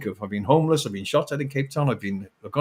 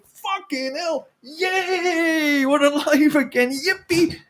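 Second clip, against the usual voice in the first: much higher, squealing delight; hammed-up glee at being alive; mock joyful wake-up; 13 semitones higher, much slower than usual. Nudging a spouse awake, hammed-up joyful squeal. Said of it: "nudge their wife or husband going".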